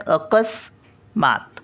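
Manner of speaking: अकस्मात stretched out, स half-said; very slow demo. Shown as a demonstration. Grandmother repeating अकस्मात very slowly for the children. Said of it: "सचा उच्चार अर्धा येतो बघा".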